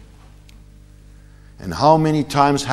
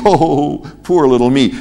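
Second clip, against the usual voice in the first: mock self-pity; 3 semitones higher, 4 dB louder than usual. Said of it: "Self-pity".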